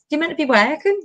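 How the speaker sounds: teasing. Retold or imitated. Teasing her best friend's dad for not working, mock-stern. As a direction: rib him like he's skiving. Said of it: "like, taking the piss".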